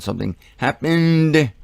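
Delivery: HAPPENED drawn out slowly; emphatic insistence. Real, not acted. Host insisting a signpost event took place, emphatic. Emphatic repeat of his claim.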